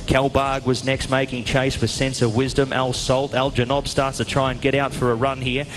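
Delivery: ordinary talk, nothing marked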